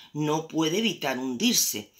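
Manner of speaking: ordinary delivery, nothing marked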